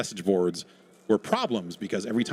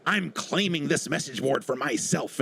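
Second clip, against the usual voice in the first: lightly hammed-up claim, higher and slower; mock self-important user; 5 semitones higher, slower than usual. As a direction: lightly imitate someone staking a claim. Voices a user claiming a board.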